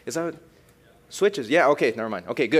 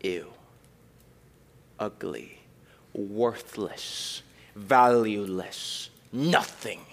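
sneering contempt; 3 semitones lower, much slower than usual. Acted. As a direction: spit each insult slowly. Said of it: "and he says".

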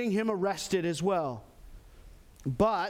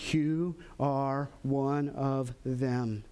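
very slow, low, deliberate accusation; 6 semitones lower, much slower than usual. Acted. Bystanders encircle Peter to accuse him, slow accusation. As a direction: space out each word, pointedly. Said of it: "begin to encircle Peter and to accuse him".

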